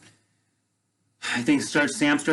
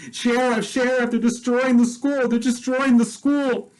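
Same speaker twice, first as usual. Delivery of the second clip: much higher, frantic retelling of the teacher's plea; panicked cry for help; 11 semitones higher than usual. Retold or imitated. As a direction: cry it up high, as if the school is burning. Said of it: "Miss Catherine's freaking out".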